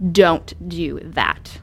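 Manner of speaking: slow, firm rebuke; stern. Retold or imitated. Parent rebuking a child, slow stern warning. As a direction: lay it down slowly and firmly. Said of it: "a little more stern".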